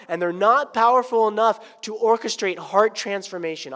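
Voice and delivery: loud, high, urgent exhortation; forceful preaching. Acted. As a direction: plead with the women.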